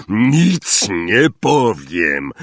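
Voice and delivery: very slow, emphatic refusal; stubborn refusal. A narrator's reading. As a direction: refuse firmly, stretching out each word. Hagrid stubbornly refuses to tell. (wolna, stanowcza odmowa)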